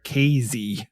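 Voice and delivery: Casey said the local way, KAY-zee with a clear Z; Casey pronounced KAY-zee as locals say it; local pronunciation. Shown as a demonstration. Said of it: "they do pronounce it there".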